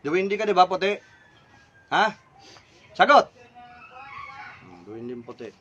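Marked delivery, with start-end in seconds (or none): 1.9-3.2 barked demand. Real, not acted. Demands answer from the spirit. 4.6-5.5 low, hushed, to himself; quiet musing. Real, not acted. Musing on the spirit's answer.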